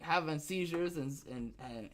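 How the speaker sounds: normal talking voice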